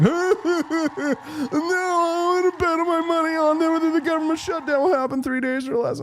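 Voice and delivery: put-on very high whiny-kid caricature; hammed-up high-pitched protest; mocking young men. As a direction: imitate dismissive youngsters in a high voice. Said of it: "they're all like".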